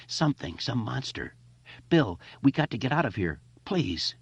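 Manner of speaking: high, panicky plea; frightened pleading. Acted. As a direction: beg to get out, scared. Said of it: "Mike choked, horrified in the darkness".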